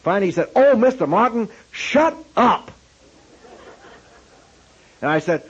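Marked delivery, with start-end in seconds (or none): 0.5-2.7 exasperated snap at a student; retold angry outburst; angry professor. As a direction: cut him off in anger. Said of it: "He got very angry".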